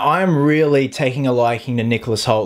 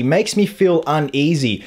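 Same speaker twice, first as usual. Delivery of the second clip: ordinary talk, nothing marked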